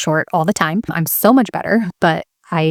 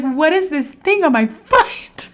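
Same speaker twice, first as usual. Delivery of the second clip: much higher, slow, baffled question; confused alarm; 11 semitones higher than usual. Retold or imitated. Mom stepping on something slimy inside, baffled and high. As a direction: puzzle over the slime underfoot. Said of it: "I was like".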